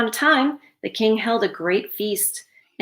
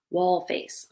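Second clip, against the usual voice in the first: usual speaking voice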